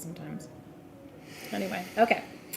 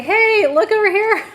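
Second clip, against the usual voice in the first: high, loud call for attention; attention-seeking raccoon; 12 semitones higher, 11 dB louder, slower than usual. Acted. Raccoon tapping on the glass for attention, high insistent call. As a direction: pipe up loudly, drawing out the words.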